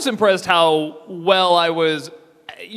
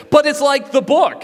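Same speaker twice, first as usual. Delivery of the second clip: higher, louder whine of objection; mock protesting fans; 10 semitones higher, 5 dB louder than usual. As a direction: imitate an indignant book fan protesting. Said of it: "they'll say".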